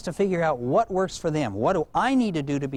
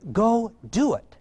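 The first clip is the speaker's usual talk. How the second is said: normal talking voice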